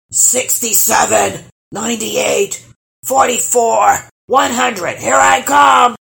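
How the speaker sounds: loud, high troll count rising to a shout; calling out. A narrator's reading. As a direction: shout the count as the seeker, biggest at the end. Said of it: "called the troll".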